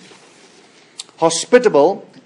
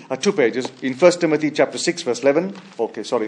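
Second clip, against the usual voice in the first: ordinary talk, nothing marked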